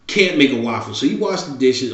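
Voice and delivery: usual speaking voice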